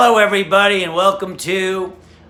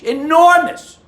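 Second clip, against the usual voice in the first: much higher, louder astonished exclamation; amazed gasp; 10 semitones higher, 4 dB louder, slower than usual. Acted. Wife stunned at the sight, high amazed cry. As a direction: gasp it out in wide-eyed shock. Wife sees the guy's package.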